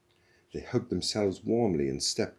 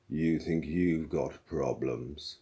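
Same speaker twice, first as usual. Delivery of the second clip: slow, gloomy, funereal drone; gloomy robot; 3 semitones lower, slower than usual. A narrator's reading. Marvin answering Zaphod, slow funereal gloom. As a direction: speak as if at a graveside. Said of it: "as if he was addressing a newly occupied coffin".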